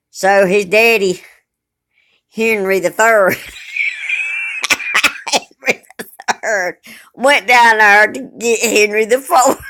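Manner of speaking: slow, loud, breaking into laughter; can't keep a straight face telling it; laughing through it. Real, not acted. Retelling the woman's story, laughing.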